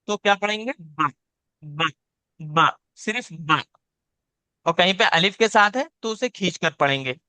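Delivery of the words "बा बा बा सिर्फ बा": बा kept short, not stretched at all. Shown as a demonstration. Teacher shows the short बा used where no alif follows. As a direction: clip बा short, with no long vowel. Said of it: "बा कहीं पे सिर्फ खाली लिखा हुआ है".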